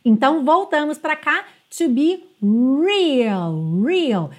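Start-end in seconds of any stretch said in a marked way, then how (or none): 1.8-4.3 slow "real", R curled back. Shown as a demonstration. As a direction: curl the R of "real". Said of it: "esse R tem que ser enrolado". ("real" com R enrolado)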